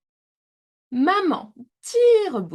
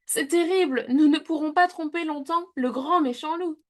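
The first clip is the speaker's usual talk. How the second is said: worried; 8 semitones higher than usual. A narrator's reading. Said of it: "maman Tire-Bouchon s'inquiète".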